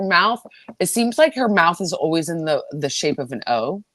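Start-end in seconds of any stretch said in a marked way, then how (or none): none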